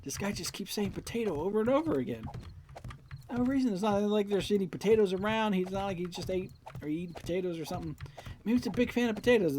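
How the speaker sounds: high-pitched mock gossip about an odd stranger; baffled onlooker. Onlookers wondering about the cashier who says potato, baffled.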